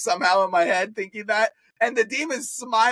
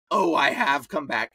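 low demon voice; 6 semitones lower than usual. Retold or imitated. Demon speaking through possessed man.

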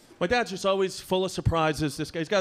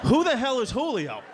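high, indignant bewilderment; exasperated high-pitched question; baffled protest; 8 semitones higher, 4 dB louder than usual. Acted. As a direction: burst out in confused protest. Baffled son protests to dad.

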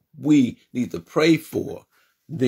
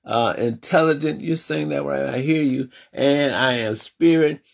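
preaching affirmations; 4 semitones higher than usual. Acted. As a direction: proclaim every I am to the congregation.

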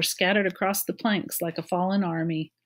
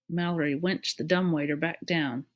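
ordinary delivery

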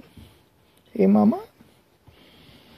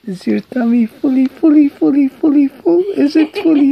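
put-on singsong baby talk; 10 semitones higher, 3 dB louder, faster than usual.

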